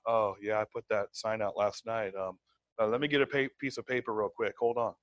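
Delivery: a low, unhurried, caught-off-guard reply; disorganized, unprepared. Retold or imitated. Seller fumbling for paper when a lead calls, unprepared. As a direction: sound like you just found the note. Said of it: "I'd be like".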